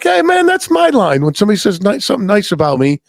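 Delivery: high-pitched mock objection; playful mock protest. Retelling his teasing comeback to an older lady, high mock protest.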